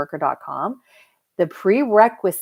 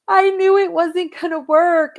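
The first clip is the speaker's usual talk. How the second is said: mock tearful wail, much higher and louder; imitating a crying client; 13 semitones higher, 7 dB louder than usual. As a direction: mimic her weepy high whine, pushed out loud. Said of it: "So she was started crying".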